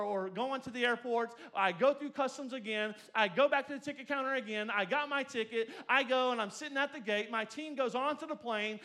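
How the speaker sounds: loud, driving sermon storytelling; full-force preaching. Acted. Preacher urging the church on, loud and driving.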